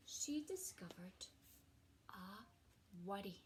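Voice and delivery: expressive storybook reading with hushed moments; gentle picture-book narration; reading to children. A narrator's reading. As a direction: read it like a cosy bedtime story to a class. Teacher reads picture book aloud.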